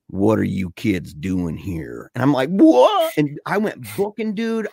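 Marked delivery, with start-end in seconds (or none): 0.1-2.1 low, slow ghostly voice in the ear; creepy, drawn-out low question; eerie voice. Retold or imitated. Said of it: "sounded like there was a voice right in my ear". 2.5-3.1 high, loud startled cry; sudden panicked holler, pitched way up; freaked-out yelp. Retold or imitated. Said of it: "I was freaking out".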